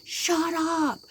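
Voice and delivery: loud angry snap leaping high in pitch; mock furious shout; imitated anger. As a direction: mimic an angry mother yelling at her child. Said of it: "angry, and just threw him".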